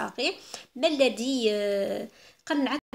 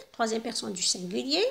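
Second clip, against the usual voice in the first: ordinary talk, nothing marked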